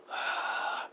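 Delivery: long soft exhale; weary sigh. Acted. Otar alone after the goddess leaves, soft long sigh. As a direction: sigh softly and let the breath run out. Voicing Otar before his speech.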